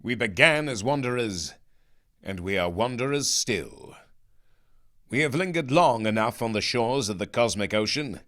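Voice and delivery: solemn, grand speech with a cheery confident edge; intoning heroic commander. A narrator's reading. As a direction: intone it like a square-jawed captain announcing destiny. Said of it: "he looked into the distance and intoned".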